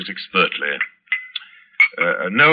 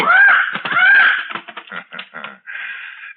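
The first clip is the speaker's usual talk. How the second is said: wild high laugh. Acted. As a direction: cackle madly at the bird. Zaroff laughs feeding his falcon.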